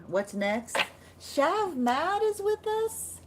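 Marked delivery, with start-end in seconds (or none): 1.2-3.2 high, gushing welcome; thrilled, stretched-out hello; excited greeting. Real, not acted. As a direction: greet a new arrival with high-pitched, drawn-out delight. Greeting a viewer who joins.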